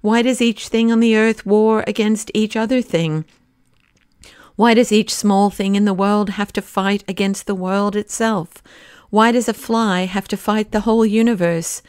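usual speaking voice

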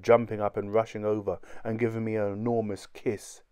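plain reading voice

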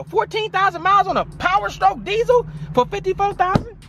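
high, thrilled disbelief at a great deal; amazed. Real, not acted. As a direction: squeak it out in delighted disbelief. Amazed at low mileage.

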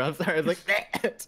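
usual speaking voice

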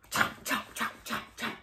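soft unvoiced chomping sounds; whispered chomping. Crocodile sneaks a bite, whispering the chomps.

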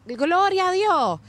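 high, drawn-out pious exclamation; mock church catchphrase. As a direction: parody a stock church phrase, slow and high. Mimicking an overused church catchphrase.

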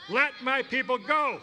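loud, high, ringing cry; with gusto. Shown as a demonstration. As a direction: shout it out with gusto. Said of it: "with just a little more gusto".

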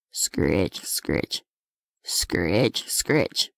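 low, rasping scritch noises; scratching sound. A narrator's reading. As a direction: scrape it out low and slow, like claws.

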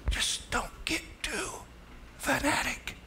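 unvoiced, slow tempter's whisper; Satan whispering. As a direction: whisper it like the devil coaxing. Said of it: "He'll encourage you".

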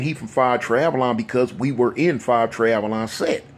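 ordinary talk

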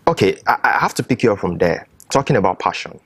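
usual speaking voice

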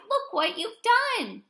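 high, drawn-out scolding; dismayed cry. A narrator's reading. Zookeeper blames the kangaroo for the mess, dismayed cry. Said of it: "he cried".

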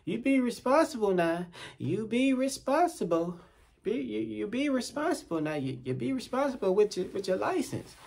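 mock her high little admonishing voice; mimicked lecturing woman.

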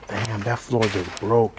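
ordinary talk

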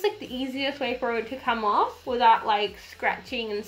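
talking while wiping face; muffled through cloth; towel over mouth. Real, not acted. Seen 0: Wet towel wipes across mouth and nose while she talks.

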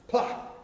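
loud spoken thud; a loud playful splat; thud sound. Acted. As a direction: smack the sound out like a body hitting the ground. Brother falls from palm.

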